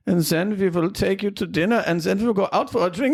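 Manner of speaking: put-on high-pitched German villain accent; caricature evil German. Podcast host mimicking evil Germans' caricature, high German accent. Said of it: "the evil Germans, like, the caricature".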